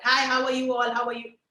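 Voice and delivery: greeting rattled off without gaps; casual, continuous hello to everyone; run-together greeting. Shown as a demonstration. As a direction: run the words straight through. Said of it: "I didn't do this with the puppet".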